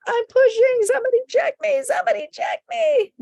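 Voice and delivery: high-pitched pleading cry, imitating a labouring mother; urgent cry for help. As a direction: call out in mock panic, very high.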